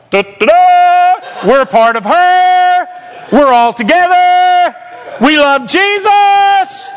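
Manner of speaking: high, slow chant with stretched line ends; jubilant cheering. Retold or imitated. Kids rally round their friend in McDonald's, jubilant high cheer. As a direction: cheer each phrase out, holding the last word.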